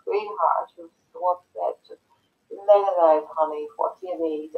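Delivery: a hint of a mother calming her upset child; mother gently soothing her crying daughter.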